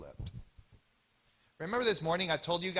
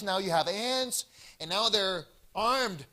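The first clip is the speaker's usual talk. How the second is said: ordinary talk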